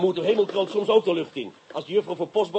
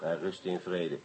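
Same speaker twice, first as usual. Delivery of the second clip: dry aside; 10 semitones lower, 10 dB quieter, slower than usual. Acted. Dry remark about the dead master.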